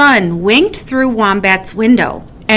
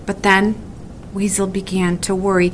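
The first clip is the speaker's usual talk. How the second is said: plain reading voice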